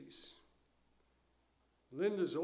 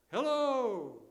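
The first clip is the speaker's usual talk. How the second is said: loud, higher-pitched call into a canyon; shouted hello; 8 semitones higher, 6 dB louder than usual. Acted. Preacher calling out over the Grand Canyon, shouted. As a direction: holler a long hello. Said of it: "so we shout".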